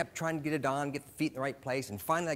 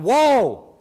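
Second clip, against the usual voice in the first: full loud, high-pitched yell; yelled command; 10 semitones higher, 17 dB louder than usual. Retold or imitated. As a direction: yell it out to halt a runaway animal. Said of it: "I tried yelling".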